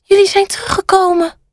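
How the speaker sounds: high little rat voice; high-pitched storybook squeak. A narrator's reading. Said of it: "Die piept".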